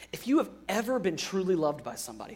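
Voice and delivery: rushed first pass, a bit soft; quick and quiet. Shown as a demonstration. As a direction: toss it off quickly before restating. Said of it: "think about this for a second".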